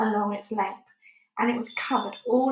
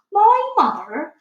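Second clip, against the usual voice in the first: much higher and louder, slow astonished giant cry; cried out; 11 semitones higher, 11 dB louder, slower than usual. A narrator's reading. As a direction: bellow the question in disbelief. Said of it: "cried the B F G".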